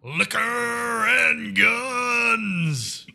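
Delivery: long, high, drawn-out bellow; full-throated sustained holler. Retold or imitated. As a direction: belt it out loud and high, stretching each word. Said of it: "[name] just bellowing".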